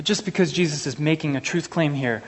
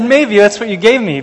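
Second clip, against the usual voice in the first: loud, higher, protesting; mock indignant protest; 7 semitones higher, 8 dB louder than usual. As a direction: push back like you've been wronged. Protesting against the app.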